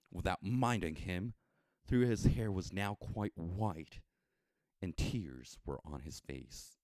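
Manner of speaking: plain reading voice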